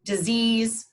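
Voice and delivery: ordinary delivery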